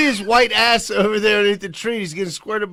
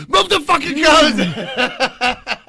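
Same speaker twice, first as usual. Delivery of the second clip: very slow, high and loud, laughing; mock boss yelling; slow furious bark; 8 semitones higher, 6 dB louder, much slower than usual. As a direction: drag out each word in anger. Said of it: "I was like".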